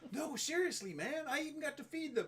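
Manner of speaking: high-pitched pretend kid insisting; mock schoolkid protesting loudly; acted earnest protest. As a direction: plead to be believed, hammed up. Acting a kid protesting to friends.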